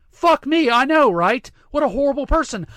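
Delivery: high, loud mock horror; hammed-up sarcastic dismay; sarcastic outrage. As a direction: feign being appalled, loudly. Sarcastically mocks outrage at the coach.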